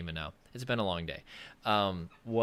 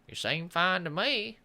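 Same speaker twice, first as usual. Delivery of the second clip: slow Southern family voice a little higher and louder; mock Southern drawl; 6 semitones higher, 4 dB louder, slower than usual. Friends and family shrugging it off in a slow Southern voice. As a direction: drawl it slowly like a Southern relative. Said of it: "I don't know if they would talk like that".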